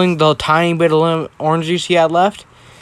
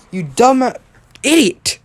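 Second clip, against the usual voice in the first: high-pitched, exasperated insult; hammed-up scolding that climbs on the last word; mock yelling; 8 semitones higher, 3 dB louder, slower than usual. Ida correcting his insult to Deku, mock yelling. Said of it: "just stop yelling".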